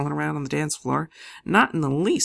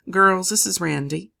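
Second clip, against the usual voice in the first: bright introduction; 4 semitones higher, 7 dB louder than usual. A narrator's reading. Audrey introduces friends.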